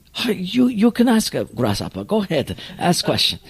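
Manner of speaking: put-on light Japanese accent in broken English; lightly voiced wise old master with a Japanese accent; Mister Miyagi voice. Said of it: "so we'll just call him Mister Miyagi".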